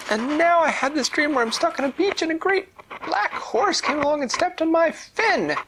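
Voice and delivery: very high, loud squeaky distressed telling; upset pufferfish. Acted. Pufferfish telling the fairy about her bad dream. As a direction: pour out the nightmare in a squeaky fish voice.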